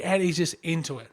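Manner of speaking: normal talking voice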